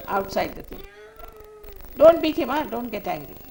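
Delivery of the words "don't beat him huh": sharp loud command. Real, not acted. Speaker stopping a parent from beating his son, loud sharp call. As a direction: call out loud to stop them, higher. Stopping someone hitting a child.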